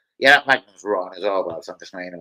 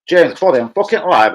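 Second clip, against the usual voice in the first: raised, high-pitched interruption to a caller; loud brush-off; 6 semitones higher, 9 dB louder than usual. Real, not acted. Streamer telling caller James he is live, loud brush-off. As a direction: call it out loudly, fend him off.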